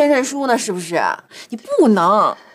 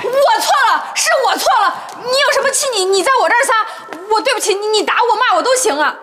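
pleading apology; 9 semitones higher, 3 dB louder than usual. Acted. Daughter-in-law begs mother.

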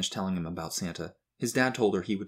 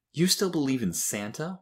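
higher-pitched schoolyard taunt; mocking; 6 semitones higher than usual. A narrator's reading. Boy teasing classmate about Santa, mockingly. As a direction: jeer like a kid making fun. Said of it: "asked in a mocking tone".

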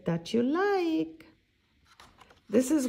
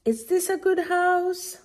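squirrel asking; 10 semitones higher, 4 dB louder than usual. Acted. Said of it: "Squirrel is asking".

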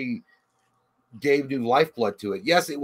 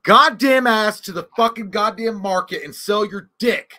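mock rant; 7 semitones higher, 6 dB louder than usual. Mock angry rant at co-host.

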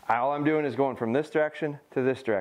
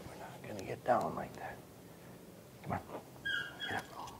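much softer, whispered no; whispered correction; 13 dB quieter than usual. Real, not acted. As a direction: correct her quietly. Quiet correction to dog.

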